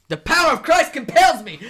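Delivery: high, very loud shout; mock exorcist battle cry. [name] stabbing Angelo, high hammed-up yell. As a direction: bellow it with hammed-up zeal.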